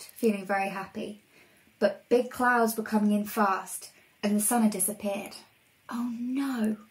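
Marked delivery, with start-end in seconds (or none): 5.9-6.8 whispering. Acted. Velda dismayed by clouds.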